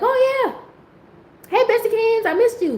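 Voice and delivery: high-pitched, mock-gushy hello; gushing greeting. Speaker greets a friend she forgot about, high gushing.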